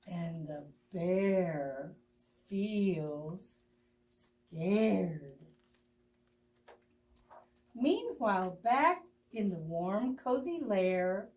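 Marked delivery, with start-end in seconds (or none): 0.1-5.4 slow ominous refrain. A narrator's reading. Refrain read slowly.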